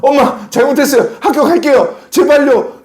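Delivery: put-on high-pitched child pleading with a parent; desperate begging. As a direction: imitate a kid frantically begging forgiveness. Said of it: "무릎 꿇고 비기기 시작했어요".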